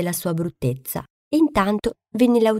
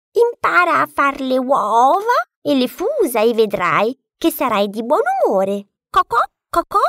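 stuttering clucking hen; 6 semitones higher, 5 dB louder than usual. A narrator's reading. Hen scoffs at the duckling, clucking high. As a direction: cluck every word start like a flustered hen.

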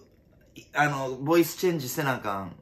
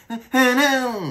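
higher, drawn-out Pokémon call; Geodude cry; 10 semitones higher, 6 dB louder, slower than usual. Retold or imitated. Comedian recalling a comparison video, imitating a higher Pokémon cry. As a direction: mimic the rock Pokémon's cry. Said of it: "今聞き直してないからわからんけど".

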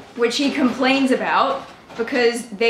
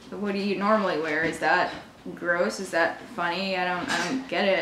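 low, soft, uninterested questioning; unamused deadpan; 6 semitones lower, 7 dB quieter than usual. Retold or imitated. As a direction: refuse the joke in a low, quiet monotone. Unamused reply to date's joke.

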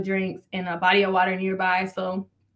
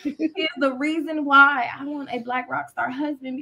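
normal talking voice

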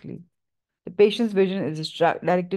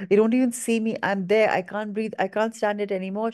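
usual speaking voice

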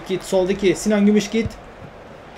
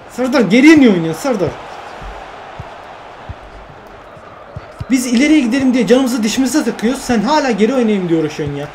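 exasperated scolding; 3 semitones higher than usual. Real, not acted. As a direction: vent at him, voice pushed up. Scolding his own player Serdar.

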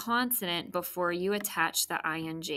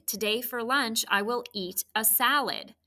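ordinary talk

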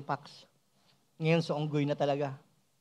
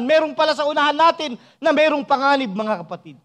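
building to a high, loud pulpit cry; full-force preaching; 7 semitones higher, 10 dB louder than usual. Real, not acted. Preacher urging the congregation to know God's salvation, full-force preaching. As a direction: proclaim it with rising force.

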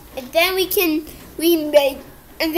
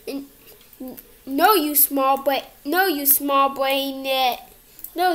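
ordinary talk

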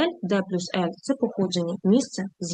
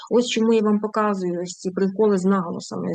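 normal talking voice

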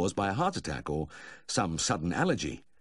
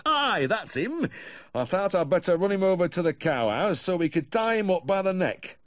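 cheerful farmer; 12 semitones higher, 4 dB louder than usual. A narrator's reading. Farmer agreeing with the vet about tying up the bull, cheerfully. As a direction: answer brightly and high, as a cheery farmer. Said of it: "nodded cheerfully".

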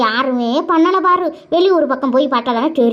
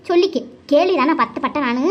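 ordinary talk, nothing marked

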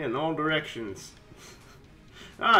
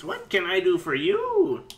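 normal talking voice

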